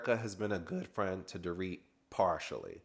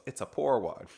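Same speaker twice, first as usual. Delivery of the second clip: snooty put-down; 3 semitones higher, 3 dB louder than usual. Retold or imitated. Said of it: "And she goes".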